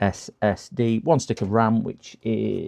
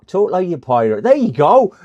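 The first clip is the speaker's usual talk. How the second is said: louder, higher hammed-up pirate growl; mock pirate voice; 6 semitones higher, 9 dB louder than usual. Shown as a demonstration. Streamer asked to talk like a pirate, hamming it up. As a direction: play the pirate for the chat.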